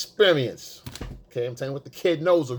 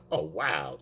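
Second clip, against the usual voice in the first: low, softer reaction; masculine frame; 11 semitones lower, 5 dB quieter than usual. Shown as a demonstration. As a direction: stay low and level while sounding interested. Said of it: "your masculine frame".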